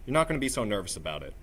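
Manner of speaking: normal talking voice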